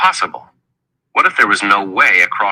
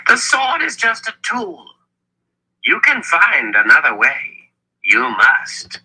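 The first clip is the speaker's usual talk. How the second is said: Zed's emphatic urging; 10 semitones higher, slower than usual. A narrator's reading. Zed urging Richard to find another way, emphatic urging. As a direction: start high and insistent, descend to an earnest plea.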